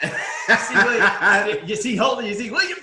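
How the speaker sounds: high, loud, delighted shouting through laughs; laughing excitement. Real, not acted. Host points out teammates in the picture, excited. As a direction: call out the names, cracking up.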